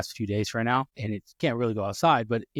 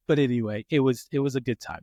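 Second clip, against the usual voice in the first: normal talking voice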